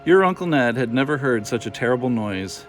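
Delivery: plain reading voice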